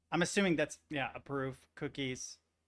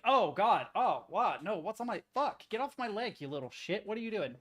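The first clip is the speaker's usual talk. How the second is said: higher-pitched mock alarm and irritation; startled annoyance; 6 semitones higher, 3 dB louder than usual. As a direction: act out a startled swimmer scolding a pest. Acts out being bitten by fish.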